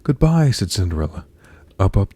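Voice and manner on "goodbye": high, loud goodbye; urgent storybook farewell; hurried farewell call. A narrator's reading. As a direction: call it out while fleeing. Cinderella calls out while fleeing.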